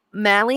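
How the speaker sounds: "Mally" said with an L for the R; Mary with L. Retold or imitated. Host asking if the nun was called Mally. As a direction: say "Mally", L sound in place of R. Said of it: "So you called Mary".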